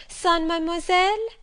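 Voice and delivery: put-on high, loud child's anxious question; the girl alarmed at losing her governess; dismayed little girl. A narrator's reading. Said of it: "asked if she was to go to school".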